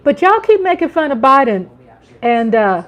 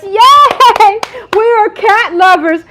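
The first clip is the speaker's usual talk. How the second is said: higher, louder cheer; excited cheer; 10 semitones higher, 8 dB louder, slower than usual. Real, not acted. Streamer cheering to viewers about loving cats, excited. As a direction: whoop it out with joy.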